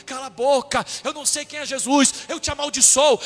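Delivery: angry outburst building in loudness; mounting, forceful rebuke; acted cursing. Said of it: "ele começou a amaldiçoar as pessoas".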